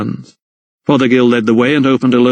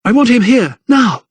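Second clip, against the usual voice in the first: raised, high pleading cry; desperate demand; 11 semitones higher than usual. Acted. Mother demanding her son.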